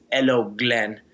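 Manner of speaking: mock Russian-accented needling; put-on Russian accent, taunting; imitated Russian accent. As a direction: mimic a Russian rival getting under your skin. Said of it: "he says in his Russian accent".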